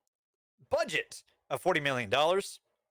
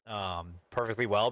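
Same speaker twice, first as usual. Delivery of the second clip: normal talking voice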